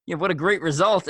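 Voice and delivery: re-enacted excited interviewer; super excited. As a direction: gush with excited praise. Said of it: "I was, like, super excited".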